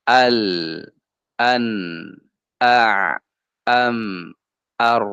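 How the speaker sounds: "al, an, a, am, ar" endings lingering then fading; half-flowing letters. Shown as a demonstration. Teacher recaps in-between letters, endings gently drawn out. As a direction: let each last consonant ring briefly. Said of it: "Ada yang diantaranya".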